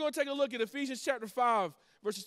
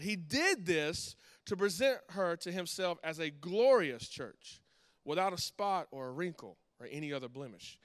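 scripture reading; 4 semitones lower than usual. Acted. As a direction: read the Word reverently, letting it sink in. Reads scripture passage aloud.

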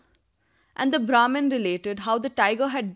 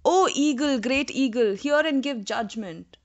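crying out; 4 semitones higher than usual. A narrator's reading. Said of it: "the Brahmin cried".

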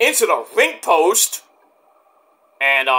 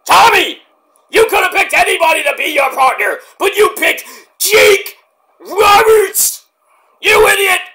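much higher, louder furious shout; enraged Funk promo shout; Terry Funk yelling; 12 semitones higher, 6 dB louder than usual. Retold or imitated. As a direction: tear into Tommy like Terry Funk. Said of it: "then he starts getting serious".

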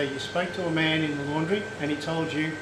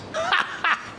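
high burst of laughter. Real, not acted. Laughs at own joke.

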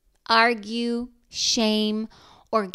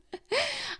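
high, light self-amused laugh; 4 dB quieter than usual. Real, not acted. Mother laughing at her own crazy thinking, amused laugh. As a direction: laugh at your own craziness. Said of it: "I'm laughing because how crazy".

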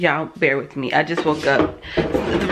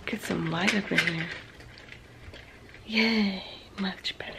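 soft relieved whisper; much softer hushed aside; 10 dB quieter than usual. Real, not acted. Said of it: "hence why I'm whispering".

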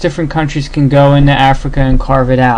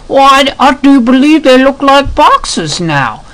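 much higher, posh-put-on British voice; mock British toff voice; British gentleman; 11 semitones higher, 3 dB louder than usual. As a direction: play a prim British officer, pitched up. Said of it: "and he's like".

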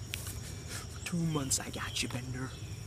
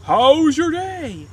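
sitcom dad; 9 semitones higher, 7 dB louder, much slower than usual. Retold or imitated. Voicing Brian's cheery dad.

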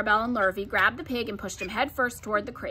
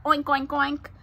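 playful mock pig grunts, higher pitch; pig oinking; 4 semitones higher, slower than usual. A narrator's reading. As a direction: oink like a boy playing pig. Boy pretends to be a pig.

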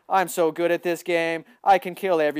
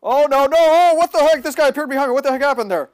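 high and loud, falling off; startled; 8 semitones higher, 6 dB louder than usual. Real, not acted. Gamer caught by an enemy from behind, high startled shout. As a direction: shout out in disbelief.

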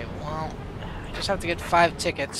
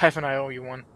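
ordinary talk

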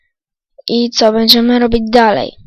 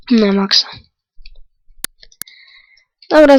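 ordinary talk

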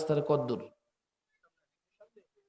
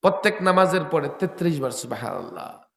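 usual speaking voice

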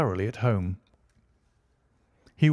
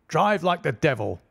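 shouting; 6 semitones higher than usual. A narrator's reading. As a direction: bark the order to hurry. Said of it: "he shouted".

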